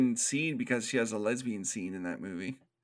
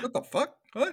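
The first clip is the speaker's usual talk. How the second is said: high startled double take, played for laughs; hammed-up confused doctor; mock bewildered doctor; 11 semitones higher, faster than usual. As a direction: pitch it up in baffled disbelief.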